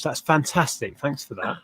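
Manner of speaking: normal talking voice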